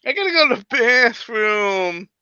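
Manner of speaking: put-on slow whiny kid complaint; pestering child. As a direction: whine it like a restless child. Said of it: "pull the kid card".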